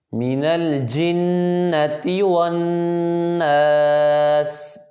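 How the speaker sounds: slow melodic tajweed chanting; Quran recitation. Shown as a demonstration. Teacher reciting a verse for students to repeat, melodic. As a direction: recite it slowly in a chanting tune.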